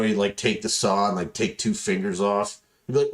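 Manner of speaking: usual speaking voice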